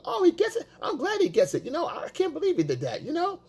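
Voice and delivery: high, gossipy mock reply; matching her energy. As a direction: parody a man gossiping up at her pitch. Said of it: "I'm gonna match her energy".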